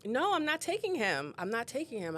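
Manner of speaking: high, urgent reassurance; calm the sobbing grandmother down fast; reassuring. Retold or imitated. Social worker reassuring a crying grandmother, urgent and high. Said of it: "and I'm just like".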